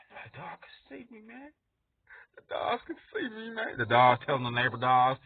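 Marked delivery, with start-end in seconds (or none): 0.1-3.8 much softer, higher, nervous hush; soft, spooked aside. Acted. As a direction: hush it like you've been caught. Employee spooked by the dog.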